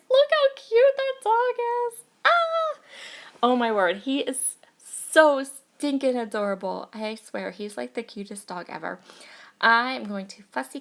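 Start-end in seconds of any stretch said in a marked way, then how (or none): 0.1-2.8 very high, loud, drawn-out squeal of delight; real high-pitched cooing excitement; gushing squeal. Real, not acted. Spots the cute dog, squeals. 3.4-6.9 loud, slow gush over something adorable; delighted, emphatic fawning. Real, not acted. Gushing over the cute dog.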